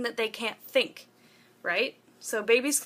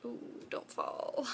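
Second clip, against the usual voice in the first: startled whisper; 10 dB quieter, slower than usual. Real, not acted. Phone about to fall.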